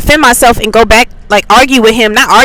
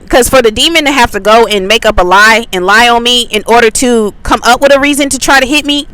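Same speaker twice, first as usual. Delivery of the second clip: ordinary delivery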